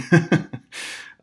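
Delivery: short high laugh at her own horror story; rueful laugh. Real, not acted. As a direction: laugh it off, a bit embarrassed.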